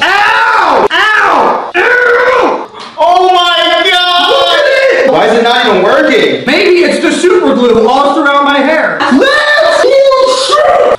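yelling in pain. Real, not acted. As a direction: cry out as it tugs. Hair glued, in pain.

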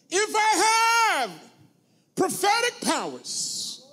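high, loud, drawn-out proclamation; declamatory scripture reading. Acted. Preacher reads scripture to the congregation, grandly proclaimed. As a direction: stretch each word out in a soaring pulpit cry.

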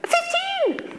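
very high laughing exclamation; exclaiming. Real, not acted. Telling the audience the punchline, high laughing cry. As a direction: burst out the reveal in disbelief.

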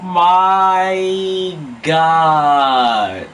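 2 loud high no, then a long laughing oh my God; 3 howl in disbelief; 4 gamer's laughing shout of disbelief; 1 real shout. Real, not acted. Disaster moment, laughing shout.

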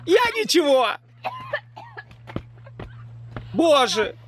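gasping through a burning mouth; pained after a fiery bite; mouth burning from spicy food. Real, not acted. Eating the hottest pepper.

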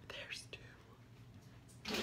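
barely audible stunned whisper. Real, not acted. Mom finding a second glass under the bed, whisper. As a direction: mouth it in disbelief.

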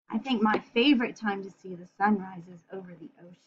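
usual speaking voice

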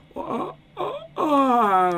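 high whiny groan; mock wail.

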